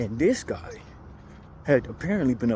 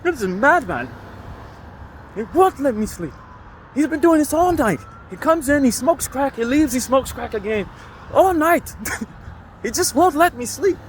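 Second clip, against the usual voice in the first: put-on angry elderly lady; 12 semitones higher than usual. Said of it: "That's exactly how she was talking".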